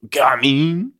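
put-on over-the-top comic French accent; exaggerated French accent. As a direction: sing out in a hammed-up French accent, rising. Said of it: "give him a French accent".

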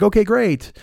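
niece's reply. Retold or imitated. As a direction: chirp it like the young niece. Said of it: "But she's like".

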